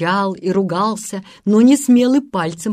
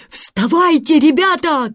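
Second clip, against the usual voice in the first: high, urgent wake-up call; 8 semitones higher, 4 dB louder than usual. A narrator's reading. Peter rouses the boys without moving, shouting. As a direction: shout to rouse sleeping friends. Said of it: "кричал Питер, не шевелясь".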